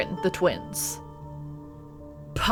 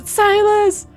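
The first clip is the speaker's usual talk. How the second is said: excited; 13 semitones higher, 7 dB louder than usual. Real, not acted. Recognizing the character excitedly.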